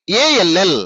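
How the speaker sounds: ALL run together as one unit; joined letters. Shown as a demonstration. Teacher modelling spelling for pupils, ALL joined without breaks. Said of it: "ఎలా పలుకుతున్నాను అనేది నేర్చుకోండి".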